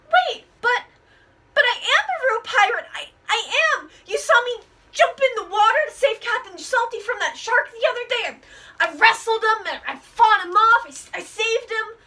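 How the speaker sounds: high, stammering sailor protesting he is a real pirate; high, eager protest, tripping over the words; flustered second mate. Acted.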